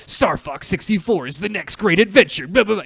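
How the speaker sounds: loud hype-trailer read; trailer announcer. Retold or imitated. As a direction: sell it like an ad.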